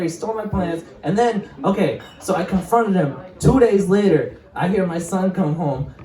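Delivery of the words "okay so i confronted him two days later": usual speaking voice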